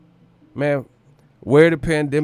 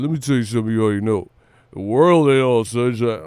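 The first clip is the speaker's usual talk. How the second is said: lower, fast put-on Rocky voice; Rocky impression; 3 semitones lower than usual. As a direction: rattle it off like Rocky lecturing his son. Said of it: "Do some Rocky impression".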